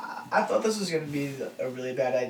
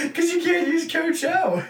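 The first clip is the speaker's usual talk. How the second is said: very high, loud squeal, drawn out; high laughing protest; 9 dB louder, slower than usual. Real, not acted. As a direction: protest in a slow, laughing high-pitched cry. Laughing protest after failed impression.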